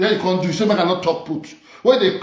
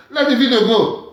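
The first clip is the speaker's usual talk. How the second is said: loud laugh, then higher, louder words; 7 semitones higher, 5 dB louder than usual. Real, not acted. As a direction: burst out laughing, then brush it off. Laughs off the complaint.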